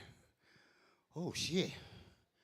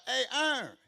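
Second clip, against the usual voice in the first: high, loud mock greeting; higher, louder imitated hey; imitated call; 10 semitones higher, 8 dB louder than usual. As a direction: call out like the guy on the roof. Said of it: "Talking about".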